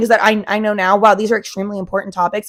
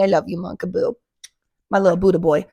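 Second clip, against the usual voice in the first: tender to puppy; 2 semitones lower than usual. Real, not acted. Talking to puppy on lap.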